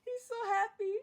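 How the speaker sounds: high, weepy laughing voice; cry-laughing. Real, not acted. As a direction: squeak it out while laughing. Cry-laughing while narrating.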